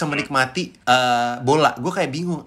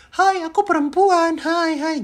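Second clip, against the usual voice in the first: high falsetto, slowed and mincing; the wrong way to do a girl voice, in thin falsetto; slower than usual. Shown as a demonstration. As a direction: squeeze it up into a cartoon falsetto. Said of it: "salahnya orang kalau suara cewek banyak kan falsetto".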